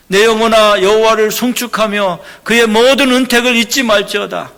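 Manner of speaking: loud, high, declaiming the psalm; impassioned scripture reading. Acted. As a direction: proclaim the verses as a sermon. Reads a psalm aloud in preaching voice.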